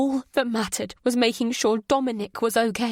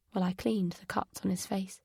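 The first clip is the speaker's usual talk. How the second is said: ordinary delivery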